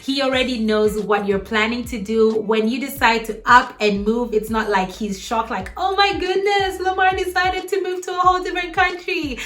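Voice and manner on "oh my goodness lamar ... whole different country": high, gasping mock surprise; mock shock. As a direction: play God as comically shocked. God imagined as shocked.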